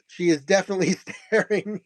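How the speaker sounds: words broken by a real laugh; amused giggle mid-sentence; laughing while talking. Real, not acted. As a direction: chuckle through the line. Laughing at picture.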